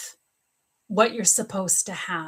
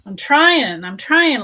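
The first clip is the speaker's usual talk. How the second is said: loud protest; 4 semitones higher, 9 dB louder, slower than usual. Real, not acted. Defending herself to a viewer.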